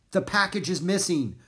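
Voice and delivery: lightly voiced yell. A narrator's reading. Gunner telling the children of the loss, mild yell. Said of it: "Gunner yelled".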